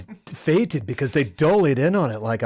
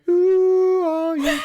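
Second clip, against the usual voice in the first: high, drawn-out sung "who are you"; high, slow mock theme-song wail; CSI theme parody; 14 semitones higher, 3 dB louder, much slower than usual. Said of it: "NCIS or whatever it is".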